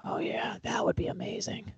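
hushed whisper aside; quick whispered aside. Real, not acted. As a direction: say it under your breath.

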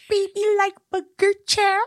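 exaggerated squeaky baby talk; high-pitched toddler voice. Co-host mocking how the ex talked, high-pitched toddler voice. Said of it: "very high-pitched voice and spoke like a toddler".

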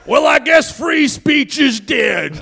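high, slow shouted lament; Alex Jones. Acted. Giant ranter under attack from airplanes, high slow shouted impression. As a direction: cry out in drawn-out mock outrage. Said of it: "Alex Jones scaling the Empire State Building".

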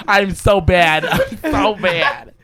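high, laughing through the words. Real, not acted. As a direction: drag it out while cracking up. Cracks up at own impression.

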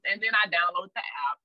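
normal talking voice

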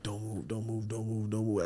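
very soft, repeated under the breath; hushed self-talk. Retold or imitated. Injured kid telling himself to keep still, hushed self-talk. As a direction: murmur it to yourself, barely moving. Retold frightened self-talk while lying hurt.

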